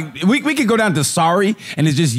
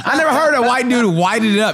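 ordinary talk, nothing marked

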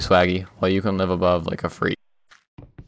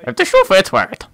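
put-on wise old sage; 8 semitones higher, 3 dB louder, faster than usual. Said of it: "Proverbs by ancient Conrad".

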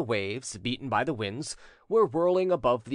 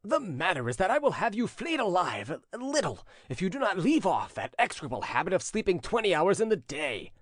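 scolding threat; 6 semitones higher than usual. A narrator's reading. Prince threatens his sleepy servant.

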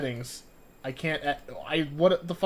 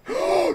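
sharp frightened gasp; 6 dB louder than usual. Real, not acted. Jump scare in horror game.

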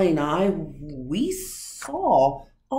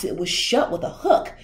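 ordinary delivery

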